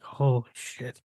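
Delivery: soft, drawn-out mutter of dismay; underwhelmed holy shit. Retold or imitated. Critic describing his own reaction to the movie, underwhelmed. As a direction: let it out slowly and quietly, unimpressed. Contrasts two ways of saying it.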